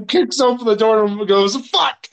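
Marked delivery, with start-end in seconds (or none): 1.7-2.1 sudden high-pitched shocked yelp; retold cry of shock, pitched up; startled curse. Michael kicking open the bathroom door, startled curse.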